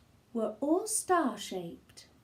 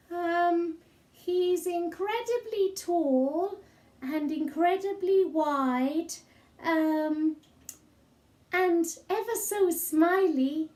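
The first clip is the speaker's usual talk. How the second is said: put-on high child voice, fibbing; lying little boy; 7 semitones higher than usual. Boy inventing Kevin for Mum, high fibbing child voice. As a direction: make it up as you go, like a small boy. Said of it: "the little boy lied".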